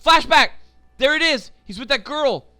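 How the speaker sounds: loud, high outburst; real burst of excitement at the screen; excited exclamation. Real, not acted. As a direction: call it out as it happens.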